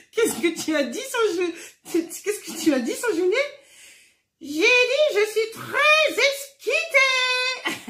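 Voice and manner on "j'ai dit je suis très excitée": high, slow puppet voice of a cheerful little friend. Acted. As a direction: greet the children as an excited, giggly puppet. Marionnette répète, voix aiguë. (voix aiguë et lente de marionnette joyeuse)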